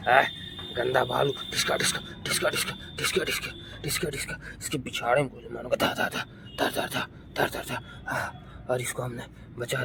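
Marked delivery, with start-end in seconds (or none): 1.3-4.4 soft breathy dhiska gun sounds; gun noise. Pretend. Playing child makes soft gunshot sounds at bear. 5.7-8.0 soft voiceless dha dha shots; gunfire noise. Pretend. Child fires the toy gun at the bear, soft breathy shots.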